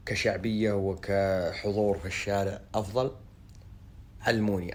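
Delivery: usual speaking voice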